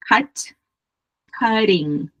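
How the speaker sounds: American flapped T in "cutting"; American pronunciation. Shown as a demonstration. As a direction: say "cutting" the American way, with a quick tapped T. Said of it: "A pronúncia americana".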